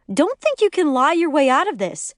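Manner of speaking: high, accusing outburst; angry screaming. A narrator's reading. Lori refusing her friend's explanation, angry high-pitched tirade. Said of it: "Lori continues screaming into my ear".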